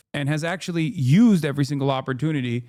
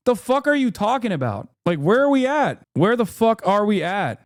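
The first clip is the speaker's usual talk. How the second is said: incredulous retort; 6 semitones higher than usual. Retold or imitated. Responding to people clipping his statements, incredulous outburst. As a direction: push back like you can't believe it.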